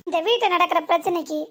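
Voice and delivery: ordinary talk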